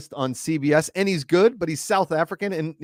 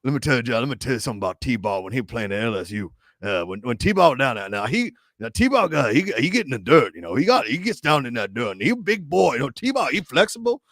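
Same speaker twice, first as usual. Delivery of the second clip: mock thick Louisiana Cajun accent; swinging, sing-song Cajun rambling; Bobby Hebert. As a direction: ramble like a Cajun football commentator. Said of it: "hung out with Bobby Hebert".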